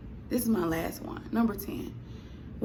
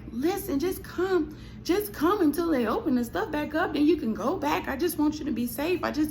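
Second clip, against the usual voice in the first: lightly voiced mom, gentle and higher; mom pleading; 6 semitones higher than usual. Mama begging her daughter to come home, higher coaxing voice.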